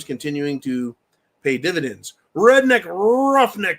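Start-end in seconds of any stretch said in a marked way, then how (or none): 2.3-3.8 announcer call-out. Acted. Announcing a caller name.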